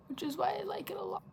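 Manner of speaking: soft unvoiced aside; whispering. Real, not acted. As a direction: whisper it like a secret. Aside about liking shimmer.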